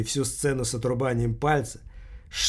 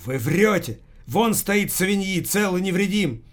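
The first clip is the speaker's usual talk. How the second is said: high-pitched hysterical protest; hysterical exclamation; 6 semitones higher than usual. A narrator's reading. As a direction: burst out in hysterical accusation, pitch jumping up. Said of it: "истерично воскликнул журнал".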